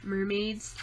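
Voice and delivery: usual speaking voice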